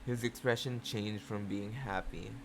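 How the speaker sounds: ordinary delivery, nothing marked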